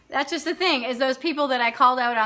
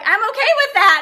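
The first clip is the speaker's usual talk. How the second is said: cheeky waiter; 9 semitones higher, 7 dB louder, faster than usual. Retold or imitated. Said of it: "And he's like".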